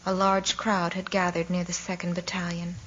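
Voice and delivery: usual speaking voice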